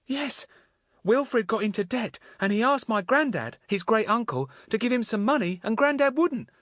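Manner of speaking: put-on high, upset voice of a trapped girl; young girl voice. A narrator's reading. Voices Marion telling her story.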